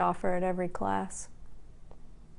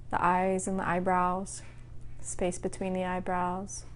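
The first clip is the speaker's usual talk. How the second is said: calm, even voice with long pauses between phrases; guided meditation. Acted. Teacher guiding the class to relax part by part, calm meditation voice. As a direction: lead a body scan, unhurried and level. Leads a body-scan meditation.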